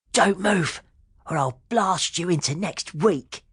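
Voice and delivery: put-on hidden kid threatening. A narrator's reading. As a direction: growl a playful threat from the bush. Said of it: "said a voice from behind a bush".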